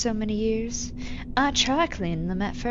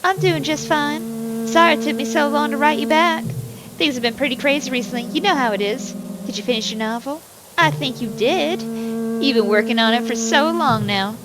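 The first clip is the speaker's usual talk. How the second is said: put-on Southern accent; 4 semitones higher, 4 dB louder than usual. Reading a daughter's letter to Daddy, in a higher, louder Southern accent. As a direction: give it a cheerful Southern twang, like a country girl writing home. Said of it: "this ain't my [name] accent".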